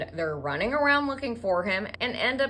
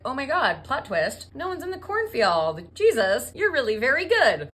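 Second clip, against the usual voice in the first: high, hammed-up astonishment; over-the-top amazed dad; mock amazement; 5 semitones higher, 5 dB louder than usual. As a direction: gush in surprise, growing louder. Father amazed at son's success.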